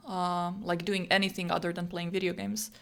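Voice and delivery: usual speaking voice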